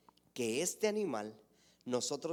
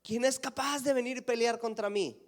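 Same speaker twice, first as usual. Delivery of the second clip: taunting shout; 8 semitones higher, 4 dB louder than usual. Acted. Goliath daring God's army to fight, shouting. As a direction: call out a giant's challenge to an army. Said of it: "y les gritaba".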